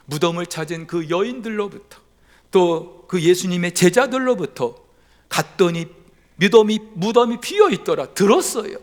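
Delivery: ordinary talk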